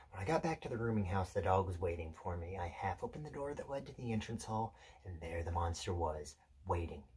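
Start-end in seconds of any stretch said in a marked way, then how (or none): 2.6-7.0 hushed suspense narration. Acted. Telling Peter how the dog crept to the meat, hushed suspense. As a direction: creep through it quietly, pausing between moves.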